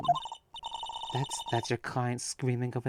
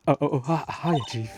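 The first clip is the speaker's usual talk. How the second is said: louder, higher startled hello; flustered greeting; 6 semitones higher, 6 dB louder than usual. Acted. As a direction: caught off guard, stammering.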